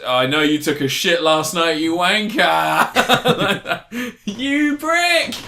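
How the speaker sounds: mock bellow, climbing high on the insult; shouted taunt. Someone turns on the invading leader, shouted taunt. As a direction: yell it as a hammed-up heckle, laughing. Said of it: "someone turns around and says".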